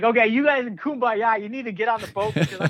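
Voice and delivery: imitating the captain. Diver mimicking his boat captain calling them back, lightly mocking. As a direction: tease the swimmers like a jealous skipper.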